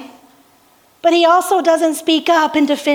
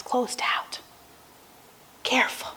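near-whispered, grave aside; very soft, low warning; hushed warning; 4 semitones lower, 12 dB quieter than usual. Real, not acted. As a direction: drop right down to a whisper, as a caution. Preacher repeats warning, dropping voice.

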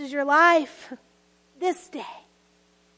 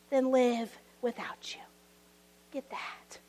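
tender whisper; 5 semitones lower, 9 dB quieter than usual. Real, not acted. Tender whispered climax of sermon.

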